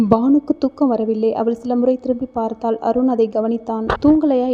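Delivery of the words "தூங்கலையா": ordinary delivery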